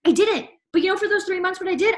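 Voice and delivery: normal talking voice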